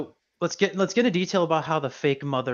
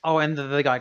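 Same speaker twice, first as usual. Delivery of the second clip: ordinary delivery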